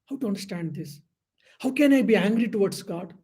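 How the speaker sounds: normal talking voice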